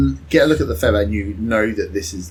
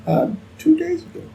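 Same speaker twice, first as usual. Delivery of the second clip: high-pitched farmer voice throughout; fussy old farmer; 8 semitones higher than usual. Acted. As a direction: talk like a doting bird-keeper. GM holds farmer voice answering players.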